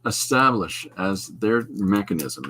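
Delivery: ordinary talk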